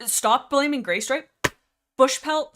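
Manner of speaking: really loud. Real, not acted. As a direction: blurt it out loud and high. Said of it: "sorry, I'm really loud".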